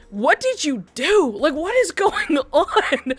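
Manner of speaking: a flooded timeline asking what happened; slow, high, amused bewilderment; baffled followers. Retold or imitated. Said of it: "so many people being like".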